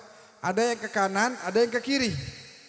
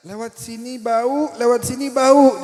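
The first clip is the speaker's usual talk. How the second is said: Lombok villager; 4 semitones higher, 9 dB louder, slower than usual. Retold or imitated. Orang Lombok menjawab.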